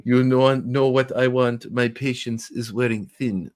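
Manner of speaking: put-on slow, lightly German-accented menace; a hint of a slow German accent; Werner Herzog. Mock Herzog for a friend, slow hint of German. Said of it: "I just think of Werner Herzog".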